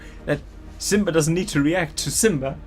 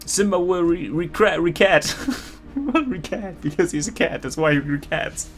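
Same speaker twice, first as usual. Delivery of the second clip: mock American accent, pitched higher; exaggerated American accent; 4 semitones higher than usual. Reading the author's note aloud, in a mock American accent. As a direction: read it like a gushing American teen writer, a hint of American. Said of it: "in the most American accent I can gather".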